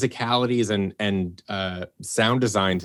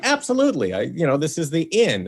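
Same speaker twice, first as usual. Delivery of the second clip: excited yes; 7 semitones higher than usual. Retold or imitated. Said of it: "And I was like".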